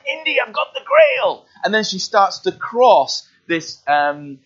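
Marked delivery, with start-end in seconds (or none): 0.1-1.5 high, loud triumphant cry of Elsa; excited Elsa. Retold or imitated. Preacher acting Elsa, triumphant high cry. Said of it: "she's like".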